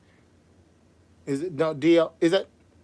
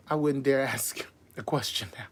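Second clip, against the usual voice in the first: conspiratorial whisper; 4 semitones lower, 7 dB quieter than usual. Real, not acted. Host whispering an aside to the chat. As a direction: lean in and whisper it.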